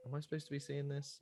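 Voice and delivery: hushed inner thought; quiet aside. Pretend. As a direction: drop to a near whisper. Voicing viewer's inner thought.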